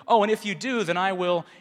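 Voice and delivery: a loud self-satisfied promise to God; smug bargain. Retold or imitated. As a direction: offer it like a big favor. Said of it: "I'm gonna do him a favor".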